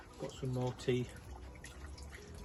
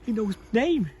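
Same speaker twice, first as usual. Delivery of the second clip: thrilled; 10 semitones higher, 8 dB louder than usual. Acted. Zacchaeus overjoyed.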